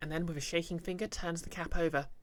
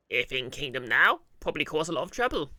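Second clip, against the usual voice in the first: worried goblin; 8 dB louder than usual. Acted. Goblin warning of trouble in the kingdom, loud and worried. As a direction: loudly guess as a troubled goblin.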